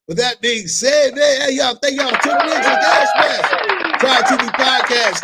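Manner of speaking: loud, high hype outro shout; host yelling the show's farewell; shouted sign-off. Real, not acted. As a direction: belt the thank-you to the listeners. Loud podcast sign-off to listeners.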